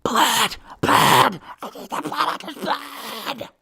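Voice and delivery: high, strangled cries that start loud; bloodthirsty heart. Acted. A living heart demands to be fed, bloodthirsty shrieking. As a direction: cry out like a ravenous creature. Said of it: "the heart".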